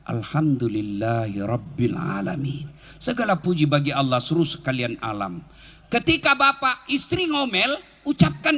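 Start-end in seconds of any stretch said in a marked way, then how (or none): 0.0-2.7 low and slow, drawn out; slow, lower praise; slow grateful praise. Shown as a demonstration. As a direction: let it sink into the heart. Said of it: "supaya dia berkesan di hati".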